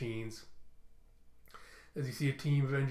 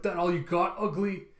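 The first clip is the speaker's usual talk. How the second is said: taunt; 5 semitones higher, 5 dB louder than usual. Retold or imitated. Tullus taunting the worm.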